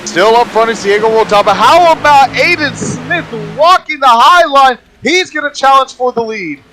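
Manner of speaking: high, excited race call with stretched names; live play-by-play. Real, not acted. Co-commentator spotting a challenge for the lead, excited high play-by-play. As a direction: lift the pitch as the challenge builds.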